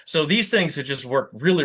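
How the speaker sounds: normal talking voice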